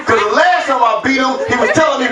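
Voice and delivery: normal talking voice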